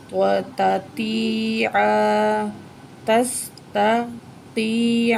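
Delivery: تستطیع split slowly into تس and تتیع; slow careful sounds. Shown as a demonstration. Teacher drilling a hard word slowly. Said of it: "تا کو با جو ہے باریک کر کے پڑھیں گے".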